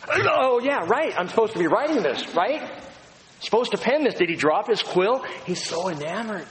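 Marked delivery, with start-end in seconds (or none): 0.1-4.1 high, startled mock John catching himself; as awed John, remember to write; John on Patmos. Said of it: "picture of John there on Patmos going".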